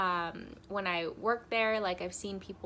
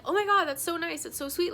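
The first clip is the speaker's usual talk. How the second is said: higher, louder imitation of a gushing barista; delighted gushing; 8 semitones higher, 5 dB louder, faster than usual. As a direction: gush as if retelling someone's delight. Barista's delighted reply retold.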